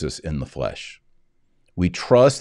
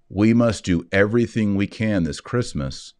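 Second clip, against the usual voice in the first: ordinary talk, nothing marked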